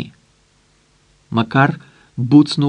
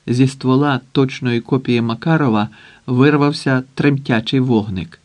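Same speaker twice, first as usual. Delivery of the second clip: ordinary delivery, nothing marked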